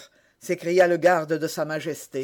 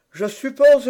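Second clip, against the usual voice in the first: high, louder cutting retort; haughty retort; 7 semitones higher, 8 dB louder than usual. A narrator's reading. Aramis answers D'Artagnan's challenge with a high, louder retort. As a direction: snap the words back at him. Quarrel over the handkerchief.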